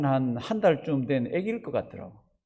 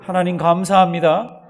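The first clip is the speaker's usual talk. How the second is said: louder, stress on 감사합니다, rising; louder repeat; 10 dB louder than usual. Shown as a demonstration. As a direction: push 감사합니다 up and out. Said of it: "절 한번 따라 하십니다".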